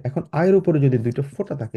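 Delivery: ordinary talk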